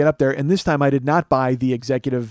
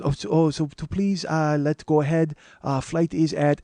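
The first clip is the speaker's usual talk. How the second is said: put-on slow, halting Japanese-accented plea; imitated Japanese businessman, hesitant and courteous; soft Japanese accent; 3 semitones higher than usual. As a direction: politely grope for the English words in a Japanese accent. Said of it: "gonna do that Japanese voice".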